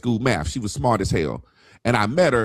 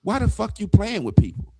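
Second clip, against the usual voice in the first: higher-pitched imitation of an angry woman; mock indignant question, pitched up; retold annoyance; 8 semitones higher than usual. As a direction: act out her indignant challenge, mildly. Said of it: "she got mad right then".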